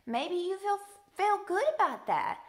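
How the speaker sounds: lifted, positive delivery; upbeat. Real, not acted. Offering happy versus sad feelings.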